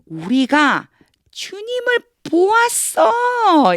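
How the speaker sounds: excited disciples. Acted. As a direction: shout the joyful news. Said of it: "이렇게요".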